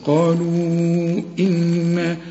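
إن with the N held and nasalized, slowly; nasal ghunna. Shown as a demonstration. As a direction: hum the N of إن. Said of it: "النون لازم تغن".

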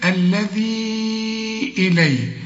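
الذي إليه with the ya drawn out long and slow; long madd. Shown as a demonstration. Teacher drawing out the separated madd in الذي. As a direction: stretch the madd in الذي right up to the hamza. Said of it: "عندي مد لأنه بعده همزة".

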